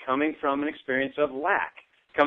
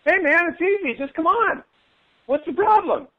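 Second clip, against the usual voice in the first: much higher, breezy mock guru voice; 12 semitones higher, 4 dB louder than usual. Gurus on the abundance side brushing off the struggle, high breezy mock voice. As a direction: toss it off like it's nothing, high and airy. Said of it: "gurus and teachers ... basically saying".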